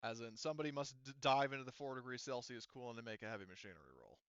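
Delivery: normal talking voice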